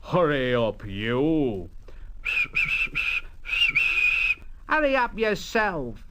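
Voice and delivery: grand, scornful big engine voice; haughty engine. Acted. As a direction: sneer at the little engine. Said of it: "and Gordon said".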